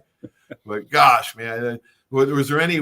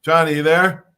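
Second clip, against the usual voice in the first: calling out; 3 semitones higher, 7 dB louder, faster than usual. Real, not acted. Host calling a dropped caller.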